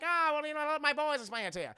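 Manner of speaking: lightly hammed-up mobster; gangster boss voice. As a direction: play a small-time crime boss. Voices Lil Capone replying.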